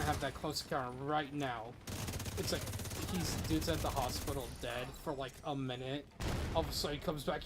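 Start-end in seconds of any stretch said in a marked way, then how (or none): none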